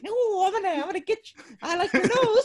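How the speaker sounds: very high, hammed-up villain voice; creepy shady doctor. Mustached shady doctor coming for a nose, high creepy mock voice. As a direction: play a silly sinister doctor. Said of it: "like a little shady doctor".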